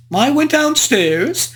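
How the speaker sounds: usual speaking voice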